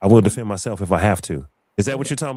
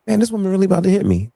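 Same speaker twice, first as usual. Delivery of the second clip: disbelief; 10 semitones higher, 3 dB louder, faster than usual. Retold or imitated. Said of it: "I said".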